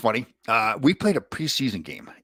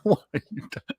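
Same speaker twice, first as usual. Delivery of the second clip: high, incredulous scolding; mock scold; 11 semitones higher than usual. Old enforcer teases the rival, high mock scolding. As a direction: act amazed at his nerve. Quoting Dave Brown, retold.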